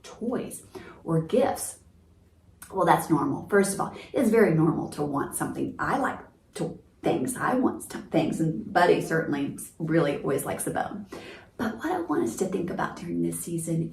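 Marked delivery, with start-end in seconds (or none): none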